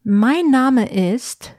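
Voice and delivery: "Mein Name ist" said slowly and clearly; slow model phrase. Shown as a demonstration. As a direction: spell it out slowly for a learner. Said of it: "Na to pytanie odpowiemy". (powoli i wyraźnie "Mein Name ist")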